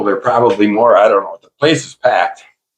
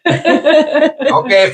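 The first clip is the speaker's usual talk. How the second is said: laughing okay. Real, not acted. Laughing while wrapping up.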